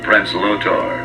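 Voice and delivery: usual speaking voice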